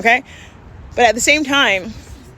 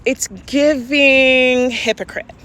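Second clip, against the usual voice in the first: drawn-out slang; much slower than usual. Real, not acted. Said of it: "slang delivery, drawn out".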